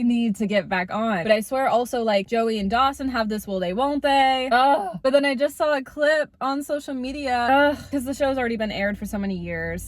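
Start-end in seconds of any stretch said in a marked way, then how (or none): 4.5-5.0 exasperated groan. Real, not acted. Frustrated over will-they-won't-they show. 7.5-7.9 exasperated groan. Real, not acted. Frustrated over clip spoiling show.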